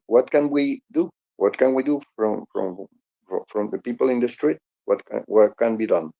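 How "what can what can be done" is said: usual speaking voice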